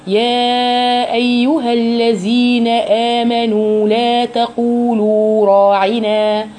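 slow chanted recitation; Quran recitation. Shown as a demonstration. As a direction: recite evenly with drawn vowels. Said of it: "حركتين زيه زي المد الطبيعي".

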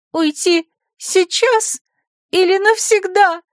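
high, slow, tearful-sounding question; sobbing secretary, lightly. Acted. Offended secretary asks the rector, high with a hint of sobbing. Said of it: "громко всхлипнула Талула".

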